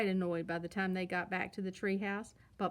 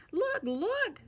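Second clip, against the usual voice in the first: much higher, louder cry of thrilled cubs; excited shout; 11 semitones higher, 4 dB louder than usual. A narrator's reading. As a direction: shout it like kids seeing new toys. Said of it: "shouted the cubs".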